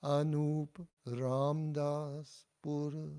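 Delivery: slow teacher's demo of Anup Ramdas Pur; slow model phrase. Shown as a demonstration. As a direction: give Anup Ramdas Pur clearly for students to copy. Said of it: "And me".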